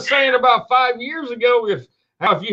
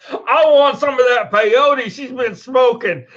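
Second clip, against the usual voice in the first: giggling, high and a bit loud; laughing through quote; 4 semitones higher, 3 dB louder than usual. Retold or imitated. Joking to cohost about the peyote woman, laughing. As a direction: quote squeezed out between laughs. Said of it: "And then she goes".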